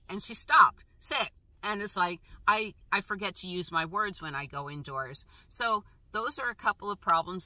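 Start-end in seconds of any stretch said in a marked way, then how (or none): 1.0-1.3 high one-word dog order; re-enacted command, pitched up; brief command. Owner telling the dog to sit, brief command.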